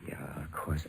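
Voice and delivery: barely breathed, all voice gone; a crushed, defeated whisper; broken whisper. Acted. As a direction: let it die in a whisper. Crushed, agrees to part.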